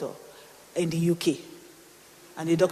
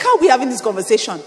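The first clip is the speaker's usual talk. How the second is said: loud, higher, hurried protest; upset; 5 semitones higher, 10 dB louder, faster than usual. Retold or imitated. Preacher re-enacting her argument with God, upset. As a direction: demand it in re-enacted outrage. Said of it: "I was so upset".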